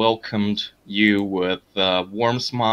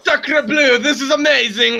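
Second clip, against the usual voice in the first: higher, delighted French-tinged cry; excited French exclamation; 4 dB louder than usual. Acted. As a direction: gush over the feast. Alien host delighted by food.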